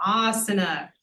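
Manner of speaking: "asana" as AA-sana, first vowel long; stress on long vowel. Shown as a demonstration. Teacher giving the rule, front-stressed "asana". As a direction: lean on the long opening "a" of "asana". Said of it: "put the emphasis on the long vowel".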